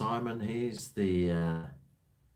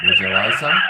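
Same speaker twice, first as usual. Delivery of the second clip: loud mock chat-up offer; acted punchline; 4 semitones lower, 8 dB louder than usual. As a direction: belt out the pickup punchline. Acted punchline of pickup line.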